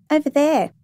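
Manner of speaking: put-on high child's voice; little girl. A narrator's reading. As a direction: answer like a small girl. Little girl answers a question.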